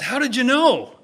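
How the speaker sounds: loud, high-pitched mock astonishment; surprised. Adam Smith reacts to the shepherd's correct guess, acted surprise. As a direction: exclaim it in hammed-up amazement. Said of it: "Adam Smith's turn to be surprised".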